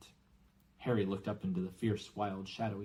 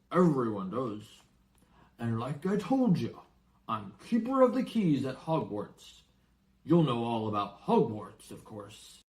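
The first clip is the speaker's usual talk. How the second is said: louder giant, breezy; Hagrid; 6 dB louder than usual. Acted. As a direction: assume the boy already knows. Hagrid explains to Harry.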